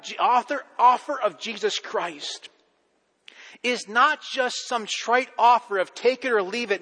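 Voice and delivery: high, loud, building sermon voice; preaching at full force. Acted. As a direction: drive the point home to the congregation.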